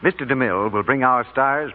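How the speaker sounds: usual speaking voice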